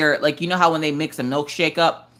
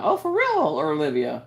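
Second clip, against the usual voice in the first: surprised; 4 dB quieter than usual. Real, not acted. Reacting to a viewer's comment.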